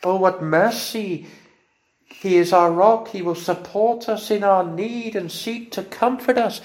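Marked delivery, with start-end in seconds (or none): none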